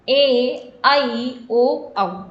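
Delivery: ए, आई, ओ, औ said long, one by one; long vowels. Shown as a demonstration. Teacher listing long vowels, ए, आई, ओ, औ held out. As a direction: give ए, आई, ओ, औ two beats each. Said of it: "ये सारे आपके दीर्घ स्वर होते हैं".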